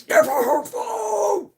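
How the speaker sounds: loud, high, slow barked order; commanding shout. Retold or imitated. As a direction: yell it like the boss defending her. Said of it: "Andre comes barreling out".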